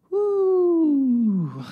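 high drawn-out "ooh" sliding down; mock-awkward falling hoot; long falling "ooh". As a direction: let out a long, sinking ooh at an awkward moment. Reacting to the awkward room.

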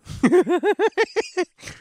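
high squealing laughter; real burst of giggles; loud high laugh. Real, not acted. Laughing at joke.

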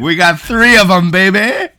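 loud, high hype-man yell; hyped shout. Pretend. Hyped joke boast.

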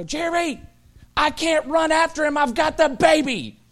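high, loud call across a yard; mock-frantic wife shouting for help. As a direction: yell for your husband while holding the baby.

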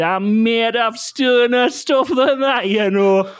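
owner quoting the dog, high and slow; high drawn-out dog line; high, slow mock dog phrase. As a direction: stretch it out like the dog. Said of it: "he just looked up and he said".

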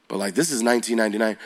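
ordinary talk

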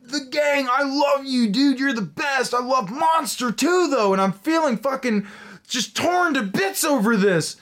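high, overexcited gushing voice; gushing rocker. Retold or imitated. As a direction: gush like a starstruck fan. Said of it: "he's like".

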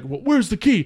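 first mock demand, not yet yelling. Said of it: "He starts, like, yelling".

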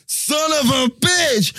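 high, drawn-out mock scream; imitated child's scream. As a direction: scream it like a young kid, stretching it out. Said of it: "one young voice screaming".

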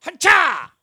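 high, loud martial arts cry; mock Bruce Lee chop yell; ha-cha kung fu shout; karate yell. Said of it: "does this fucking Bruce Lee move".

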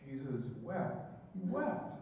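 "Jesus wept" said slowly, "wept" rising high; slow, drawn-out "Jesus wept". Shown as a demonstration. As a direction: stretch out "Jesus wept" and let "wept" rise. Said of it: "The newer translations make it a little longer".

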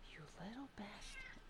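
whispered fond curse at the biting cat. Real, not acted. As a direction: hiss it affectionately under the breath. Cat bites her.

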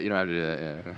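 ordinary talk, nothing marked

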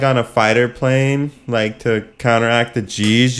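ordinary talk

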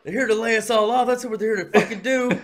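hammed-up fearful worker; mock panic. Mock paranoid employee.

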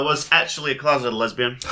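normal talking voice

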